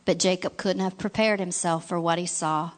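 ordinary delivery, nothing marked

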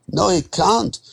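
high, distressed denial; disbelieving protest. Acted. Borrower rejecting the lender's interest and expenses, disbelieving. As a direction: push the pitch right up in refusal.

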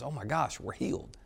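softly awed, dropping almost to a whisper; quiet, breath-caught realisation; hushed amazement. Acted. Lepers realising on the road that they are healed, hushed amazement.

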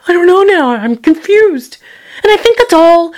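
high laugh spilling into the words; laugh, then say it high and bemused. Real, not acted. Laughing at whether to kill herself, high and amused. Laughing at her own question.

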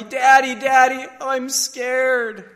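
high, loud imitation of a scared little boy; mock tearful child. Scared son calls out to his father, imitated tearful high wail. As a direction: wail it like a small son calling for Daddy. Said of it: "tears and sobs".

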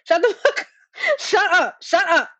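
high, half-laughing mock yell of shut up; mock outburst laughing. Podcaster pretending to yell at the defendant, high and half-laughing. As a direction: pretend to lose it, cracking up as you yell.

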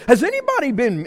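high, loud question to the room. Retold or imitated. Asking the whole crew about their day, loud and high. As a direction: ask it out loud for everyone. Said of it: "and I'm loud, okay?".

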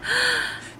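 a sudden frightened gasp, high and breathy; sharp gasp. Acted. Lady Mary gasping as she hides from Mister Fox.

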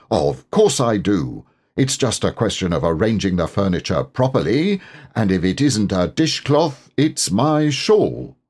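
ordinary delivery, nothing marked

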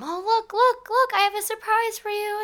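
high-pitched, eager squeal; giddy, sing-out excitement; excited. Acted. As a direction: bubble over with a surprise to share. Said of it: "you excitedly hold their hand".

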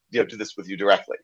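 ordinary delivery

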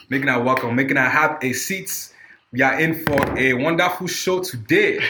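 high, slow hammed-up African village host; African accent. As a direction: welcome them like a village chief, African accent. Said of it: "I don't have my African accent together".

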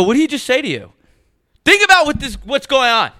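retelling the fight with the Uber driver, angry yelling; angry retold yelling. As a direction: yell at the driver in disbelief. Retold heated argument with driver.

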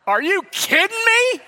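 high, slow squeal of disbelief; stunned disbelief. Retold or imitated. Pastor retelling being invited to play, squealing in disbelief. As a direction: gasp it out like you can't believe it.

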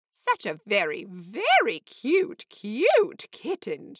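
a lightly voiced cooing lady, drawn out on very cute; Missus Nestrom cooing. A narrator's reading. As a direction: coo slowly over the kitten, rising on very. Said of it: "she said".

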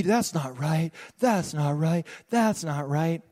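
lightly acted scandalized gossip; a hint of indignant busybody, stressing each repeat; gossiping churchgoer. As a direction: play up the disapproval a little. Acting out gossiping Christians.

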